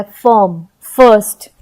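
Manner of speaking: "फर्म, फर्स्ट" with uh vowel and silent r. Shown as a demonstration. Teacher repeating the examples, uh vowel. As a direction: say "फर्म, फर्स्ट" like fuhm, fuhst. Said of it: "अ के रूप में निकला है".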